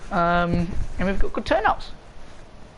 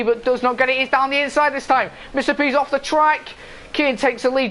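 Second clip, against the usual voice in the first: live play-by-play; 7 semitones higher than usual. Acted. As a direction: call the race start, fast and keyed up. Race start commentary.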